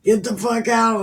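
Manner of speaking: mock-furious get out; angry brush-off. Amnon throwing her out, a loud angry snap. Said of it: "Amnon said".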